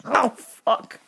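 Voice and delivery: normal talking voice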